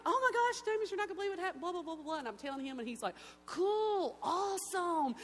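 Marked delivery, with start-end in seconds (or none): 0.0-2.2 much higher and louder, tumbling out; thrilled rush. Retold or imitated. Calling friend with big news. 3.5-5.2 high, loud, hammed-up casual reply; mock laid-back friend. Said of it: "James is just like".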